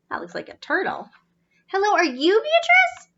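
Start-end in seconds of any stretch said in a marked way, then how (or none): 1.7-3.1 put-on eager young robot. Z asks again.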